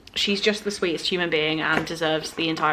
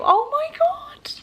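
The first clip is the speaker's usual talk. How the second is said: slow, high breathless whisper; choked up by a loving note; overcome whisper; 3 dB louder, slower than usual. Real, not acted. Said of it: "too tired for this level of emotion".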